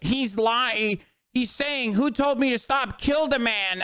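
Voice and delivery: high, mocking whiny voice. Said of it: "you have to be like".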